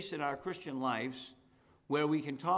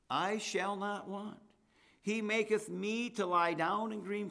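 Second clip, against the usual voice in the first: scripture reading; 4 semitones higher than usual. Acted. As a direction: lift the psalm up reverently, unhurried. Reads Psalm 23 aloud.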